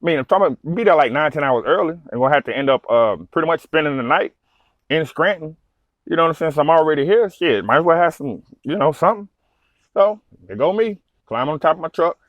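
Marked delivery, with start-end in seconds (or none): none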